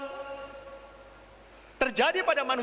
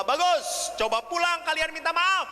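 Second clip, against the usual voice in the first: high, emphatic praise and urging; acted rousing encouragement; approving pastor; 7 semitones higher than usual. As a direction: cheer them on, then send them off.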